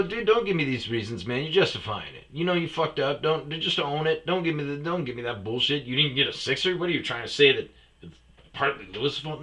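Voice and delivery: mock scolding a slacking buddy; retold scolding. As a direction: tell him off firmly.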